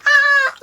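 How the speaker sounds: very high imitated "ah"; mock crowd scream. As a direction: squeal like an amazed crowd. Acts out everyone's reaction.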